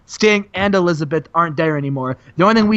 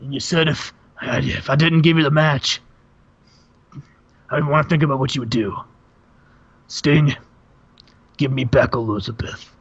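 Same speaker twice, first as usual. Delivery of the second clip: Randy Savage voice; 4 semitones lower than usual. Retold or imitated. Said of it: "cuts him off and says".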